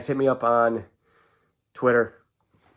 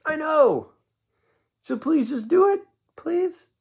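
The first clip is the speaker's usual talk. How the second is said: much higher, pleading sing-song pitch; weird inflections; slower than usual. Real, not acted. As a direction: beg in an odd high voice. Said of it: "talking with such weird inflections in my voice".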